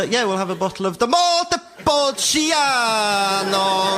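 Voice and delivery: high, very drawn-out Italian wine name; hammed-up Italian accent on Montepulciano; mock Italian accent. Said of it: "they put on this Italian accent".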